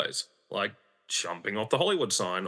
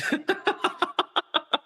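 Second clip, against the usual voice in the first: high laugh. Real, not acted. Loud burst of laughter.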